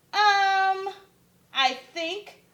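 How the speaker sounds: high hesitant um, unsure. Acted. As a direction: stall nervously before answering. Shy koala hesitating.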